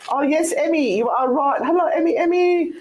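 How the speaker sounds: bright greeting. Real, not acted. Host greeting listener Emmy on air, high and cheery. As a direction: call out to a friend, delighted.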